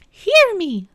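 high, loud witch's scream. A narrator's reading. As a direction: cry out in a high, imperious scream. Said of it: "the witch screamed at Aslan".

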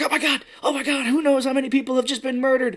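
high-pitched mock panic; hammed-up horrified newsman, voice way up; freaking out. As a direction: panic on air like a hysterical reporter. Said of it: "he starts freaking out".

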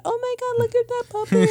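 very high, excited squeal; squealing over puppy. Retold or imitated. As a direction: coo like you've seen a puppy. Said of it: "I'll be like".

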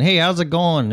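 bright, high, cheery hello; sing-song friendly greeting; mock friendly greeting. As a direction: play the fake-nice New Yorker. New Yorker friendly greeting mock.

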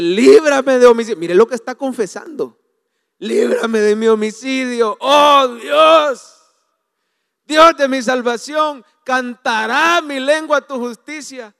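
loud, pleading shout to God; anguished cry. Retold or imitated. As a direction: cry out for deliverance. David crying out the psalm.

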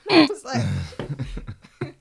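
loud burst of laughter while speaking; laughing hard. Real, not acted. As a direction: laugh out the words. Laughing at the meme.

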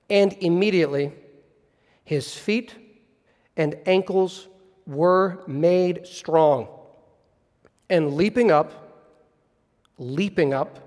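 slow, measured reading with long pauses between phrases; scripture reading. A narrator's reading. Preacher reads the healing verse to the congregation, slow and reverent. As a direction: read it deliberately, savoring each phrase and letting it settle.

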